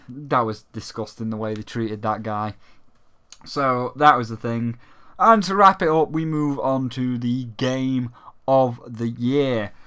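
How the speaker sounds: normal talking voice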